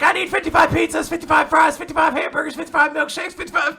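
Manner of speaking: high, fast shouted list; frantic ordering. Retold or imitated. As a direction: rattle off a huge order at the top of your voice. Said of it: "She's like".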